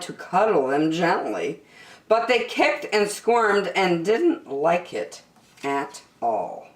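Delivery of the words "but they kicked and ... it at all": usual speaking voice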